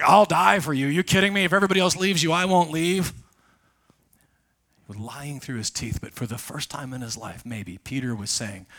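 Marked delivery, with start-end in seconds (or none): 0.0-3.1 much higher and louder, bragging loyalty; loud high boast of a cocky disciple; boastful bravado. Retold or imitated. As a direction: puff up like a braggart. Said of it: "Peter's like".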